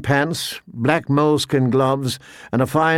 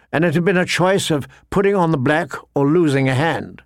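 ordinary delivery, nothing marked

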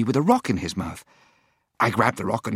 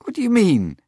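demanding; 7 semitones higher, 5 dB louder than usual. Acted. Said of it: "I demanded".

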